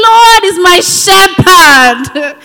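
proclaimed loudly. Pretend. Preacher answering the enemy, slow loud proclamation. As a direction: shout it out slowly at the enemy.

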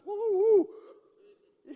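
very high, loud whoops of joy; hammed-up victory "Woo, woo"; mock whooping. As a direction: cheer like a stadium fan. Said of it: "He was more amazed".

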